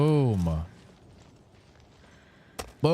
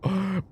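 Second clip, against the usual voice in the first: sharp breathless gasp; winded gasp; 9 semitones higher than usual. Real, not acted. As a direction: catch your breath with a gasp. Gasping after the climb.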